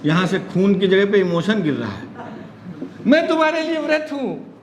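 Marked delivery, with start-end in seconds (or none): 3.1-4.6 lightly acted girlfriend, higher pitch; devoted girlfriend. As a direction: put on a high, devoted girlfriend line. (हल्की नकल में समर्पित प्रेमिका, ऊँचा सुर)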